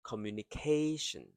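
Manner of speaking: "communication" fast up to "ca", which slows down; slowed stressed syllable. Shown as a demonstration. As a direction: race into a long "ca" in "communication", short "tion". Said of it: "K slows down".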